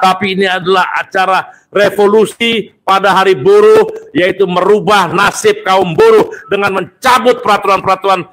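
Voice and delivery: high shouted denunciation; fiery revolutionary speech. Real, not acted. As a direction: rail against the laws to the crowd. Rally speech repeated louder.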